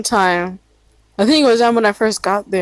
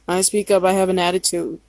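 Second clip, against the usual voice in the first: ordinary talk, nothing marked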